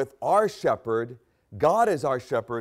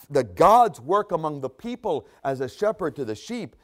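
ordinary talk, nothing marked

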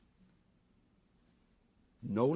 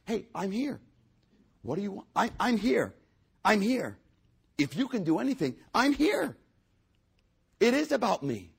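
high, loud insistence; insistent Jesus; 10 semitones higher, 7 dB louder than usual. Acted. Jesus insisting to the father he is present, high and loud. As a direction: call out I'm here, demanding attention.

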